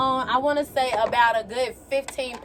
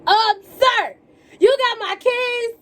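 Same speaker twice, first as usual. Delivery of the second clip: high, raised-voice demand to a stranger; calling out indignantly; 11 semitones higher, 4 dB louder, slower than usual. Retold or imitated. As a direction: holler after someone getting away, mock-polite. Calling after man during chase.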